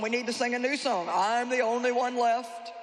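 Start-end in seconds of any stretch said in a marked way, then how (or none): 1.1-2.7 stretched out mock lament; slow self-pitying whine. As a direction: drag it like an old song. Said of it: "Same old song".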